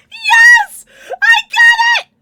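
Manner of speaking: very high, very loud scream of joy; real ecstatic squeal; overjoyed shriek. Real, not acted. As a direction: scream it out, you just won. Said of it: "I'm so happy".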